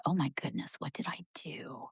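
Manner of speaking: breathy anxious whisper under the breath; nervous whisper to herself; whispered panic. Retold or imitated. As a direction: whisper it like you're scared of trouble. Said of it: "And I was like".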